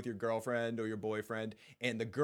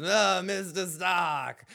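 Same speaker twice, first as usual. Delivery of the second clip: put-on slow, strained old maester voice; slow, strained, louder old-man impression; old Pycelle voice; 6 semitones higher, 7 dB louder, slower than usual. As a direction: drag it out like a creaky old man. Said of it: "I won't keep that up, it hurts my voice".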